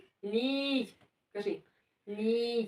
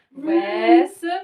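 loud demo. Shown as a demonstration. Teacher models the word once more, loud and drawn out. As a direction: stretch each syllable out loudly. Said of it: "Громко".

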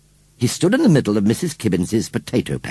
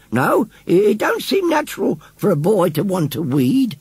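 put-on old lady voice; 10 semitones higher than usual. A narrator's reading.

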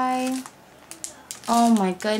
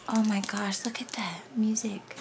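soft whispered admiration; hushed, breath-only delight; awed whisper; 8 dB quieter than usual. Real, not acted. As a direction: whisper in wonder at a find. Awed by music paper.

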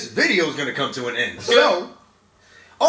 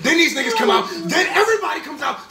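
usual speaking voice

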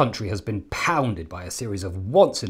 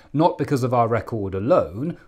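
ordinary talk, nothing marked

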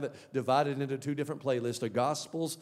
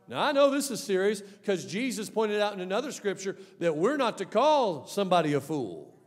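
usual speaking voice

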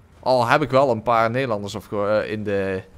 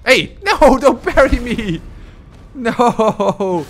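high, loud cry of dismay; protesting shout; 8 semitones higher, 6 dB louder, much slower than usual. Real, not acted. Player yells at the enemy not to parry, loud protest. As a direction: yell in protest as the attack gets blocked.

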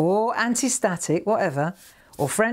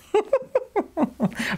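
giggle; 13 semitones higher than usual. Real, not acted. Laughing after plan B.

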